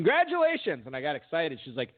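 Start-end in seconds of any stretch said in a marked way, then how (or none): 0.1-0.8 imitated high-pitched, celebratory announcement; exuberant congratulations. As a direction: congratulate like announcing a prize winner. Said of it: "She goes".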